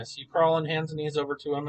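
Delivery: ordinary delivery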